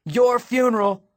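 high, loud parting shot; dismissive shout. A narrator's reading. Girl abandons boy to the crowd, shouting. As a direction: shout it over the crowd as you leave. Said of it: "she shouted in my ear".

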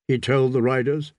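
ordinary delivery, nothing marked

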